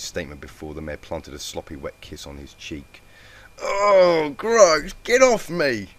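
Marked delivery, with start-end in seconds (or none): 3.6-5.9 high, loud disgusted yell. A narrator's reading. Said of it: "Sorin yelled".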